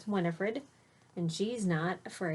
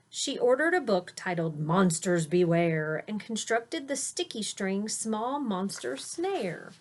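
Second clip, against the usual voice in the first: put-on lilting rhyme read to a child; read-aloud storytelling; 4 semitones higher, 5 dB louder than usual. A narrator's reading. Parent reading a rhyming bedtime book aloud to a child. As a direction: read the verse with a gentle bounce.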